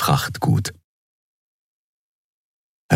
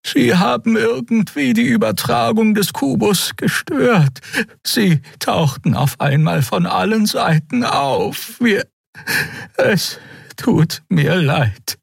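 very high, halting creature voice; trembling Draxil; slower than usual. A narrator's reading. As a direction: explain and apologize in a high, frightened voice. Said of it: "sagte die zitternde Draxil". (sehr hohe, zittrige, stockende Stimme)

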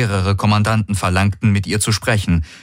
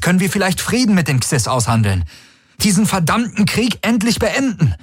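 high, insistent appeal; urgent; 9 semitones higher than usual. A narrator's reading. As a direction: plead urgently to stop a bloodbath. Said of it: "sah die Dringlichkeit ... hörte sie in seiner Stimme". (dringlicher, hoch angesetzter Appell)

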